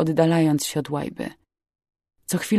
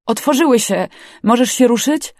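louder, higher rescuer's call; urgent raised voice; 6 semitones higher, 6 dB louder than usual. A narrator's reading. Rescuer questions trapped girl, urgent raised voice. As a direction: speak up urgently to the injured.

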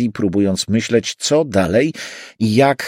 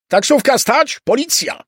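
very high, strained shout; 3 dB louder than usual. A narrator's reading. Pursuer shouting after the departing taxi, high-pitched shout. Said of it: "słysząc krzyk".

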